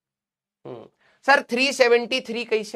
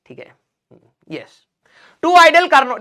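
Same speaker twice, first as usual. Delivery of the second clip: ordinary talk, nothing marked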